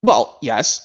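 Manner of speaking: ordinary talk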